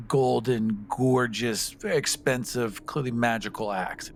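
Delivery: ordinary talk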